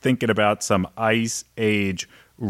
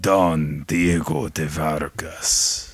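put-on lower, slow Antonio Banderas accent; 7 semitones lower, slower than usual. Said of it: "that's another good impression".

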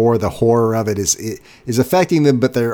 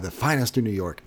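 soft, proud echo of The finest in New York; hotel staff answering proudly, softly; with such pride; 8 dB quieter than usual. Retold or imitated. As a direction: savour it quietly, like a proud boast. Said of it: "They say that with such pride".

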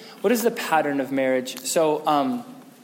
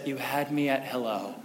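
soft tender quote; 4 semitones lower, 6 dB quieter than usual. Retold or imitated. Quoting the tender movie line.

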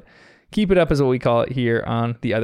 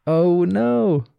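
higher, drawn-out storyteller's dismay; hammed-up "uh-oh" moment, pitched up; mock dismay; 5 semitones higher, 3 dB louder than usual. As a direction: play up the little disaster. Mock dismay at one balloon left.

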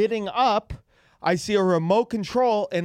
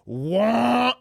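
higher, sustained machine "wah"; long mechanical whine, pitched up; imitated motor noise; 5 semitones higher, 3 dB louder than usual. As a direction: mimic a gadget changing shape. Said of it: "and then it goes".

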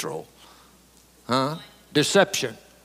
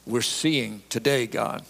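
lower, softer prayerful voice; 5 semitones lower, 3 dB quieter than usual. Real, not acted. Preacher asks God to teach the congregation, subdued prayer. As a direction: bring it down into earnest, subdued prayer.

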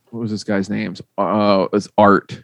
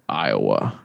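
stern teacher's answer; 12 semitones lower, 7 dB quieter than usual. Retold or imitated. Retelling the teacher's answer, a low deadpan. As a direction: voice dropped much lower and softer, deadpan. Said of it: "And he says".

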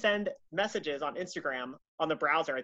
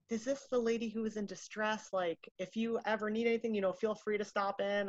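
lightly voiced kind pastor; 5 semitones higher, 7 dB quieter than usual. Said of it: "he was like".

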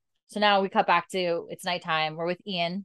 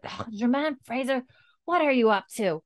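mock scolding mother; 6 semitones higher than usual. Mother scolding child, retold.

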